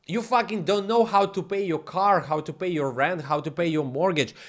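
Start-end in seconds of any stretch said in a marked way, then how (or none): none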